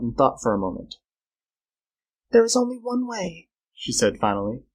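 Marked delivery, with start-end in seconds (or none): 2.3-3.5 high female character voice; grave Flora. Acted. Girl settling on a plan after thought, grave. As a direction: announce it gravely, pitched well up.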